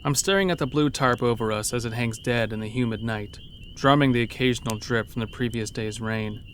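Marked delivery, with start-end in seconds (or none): none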